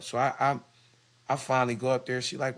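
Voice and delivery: ordinary talk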